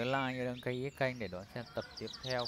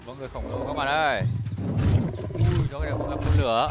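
loud excited commentary; live play-by-play; 7 semitones higher, 17 dB louder than usual. Acted. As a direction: call the monster fight loudly.

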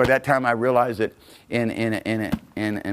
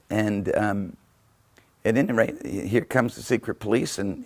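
usual speaking voice

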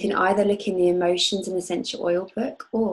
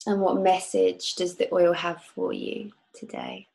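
calm guide leading a visualization; guided meditation. Acted. Leads a guided oil meditation.

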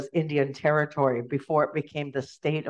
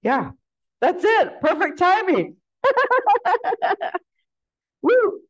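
much higher and louder, cheering; excited relief; 5 dB louder than usual. Real, not acted. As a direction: celebrate finishing, whooping. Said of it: "relief at finishing talk".

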